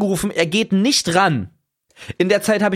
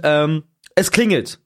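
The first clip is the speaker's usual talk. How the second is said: ordinary delivery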